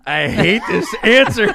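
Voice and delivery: high, slow, loud laughing moan; mock exasperated laugh; laughing complaint. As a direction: laugh through the gripe. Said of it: "I was just like".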